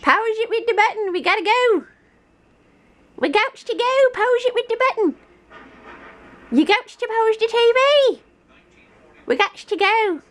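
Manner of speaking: high urgent urging. Real, not acted. Urging child to leave.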